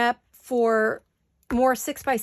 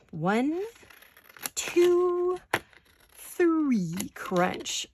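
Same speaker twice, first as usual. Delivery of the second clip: mock owl counting, the two jumping higher; Tootsie Pop owl; 6 semitones higher, much slower than usual. Retelling the old commercial, a light owl-voice count. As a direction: playfully count like the cartoon owl. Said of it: "How many licks does it take".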